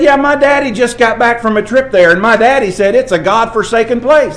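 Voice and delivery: put-on high, loud child's voice; little boy's earnest reply. Preacher voicing a boy about his daddy's trip, high and loud. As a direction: voice a kid innocently repeating his daddy.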